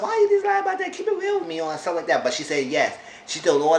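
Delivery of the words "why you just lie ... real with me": mocking Sean in a squeaky high falsetto. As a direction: throw his words back in a silly high pitch.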